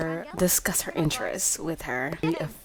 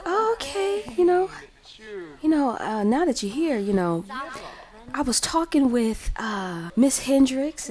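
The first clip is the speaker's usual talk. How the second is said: higher-pitched, lightly voiced grandma; acted grandma; 8 semitones higher than usual. Grandma casually bringing up Miss Hendricks to Lauren, lightly acted. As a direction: hem and haw in a higher voice. Voicing grandma Josephine broaching matchmaking.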